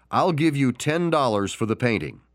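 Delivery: plain reading voice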